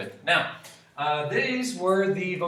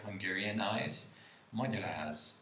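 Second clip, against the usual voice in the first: usual speaking voice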